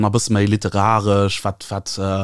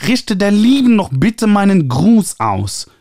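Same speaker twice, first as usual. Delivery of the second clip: high, nasal, gushing publisher voice; 11 semitones higher, 5 dB louder, slower than usual. A narrator's reading. Publisher Ernie calling the author, high nasal gushing. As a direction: trumpet it through your nose like an eager publisher. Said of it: "hörte ich ihn noch sagen".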